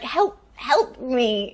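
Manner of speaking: slow, hammed-up cry for help; audition monologue. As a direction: plead melodramatically, stretching the words. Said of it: "Just started doing my monologue".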